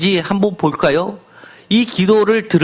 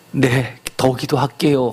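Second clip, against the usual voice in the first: meek reply; 5 semitones lower than usual. Retold or imitated. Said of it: "하는 사람도 있지만".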